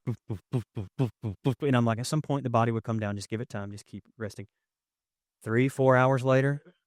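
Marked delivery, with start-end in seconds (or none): 0.0-1.7 soft, low mock heartbeat; heartbeat boops. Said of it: "our little hearts are just like". 2.0-4.5 quiet and lower, fading to a murmur; calm her down gently in the dark; soft reassurance. Retold or imitated. Soothing partner, can't sleep.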